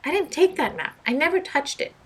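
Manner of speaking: high, loud pleading denial; desperate to be believed; protesting innocence. Acted. Doctor Peck denying the theft to everyone, high pleading. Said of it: "exclaimed Doctor Peck".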